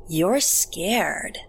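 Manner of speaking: sing-song lilt with swooping pitch; eerie, taunting creature voice; creepy creature girl. A narrator's reading. Creature girl to her terrified prey, creepy lilting taunt.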